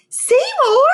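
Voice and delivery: put-on high, loud joyful shout of a little girl; happy call. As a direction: call out his name in delight. Girl calls out the hamster.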